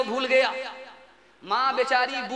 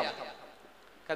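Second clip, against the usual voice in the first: whispered said; 11 semitones lower, 15 dB quieter, much slower than usual. Acted. Whispered narration.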